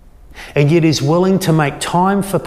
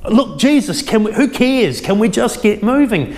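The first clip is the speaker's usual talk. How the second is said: impatient Jairus; 6 semitones higher than usual. Retold or imitated. Preacher voices impatient Jairus.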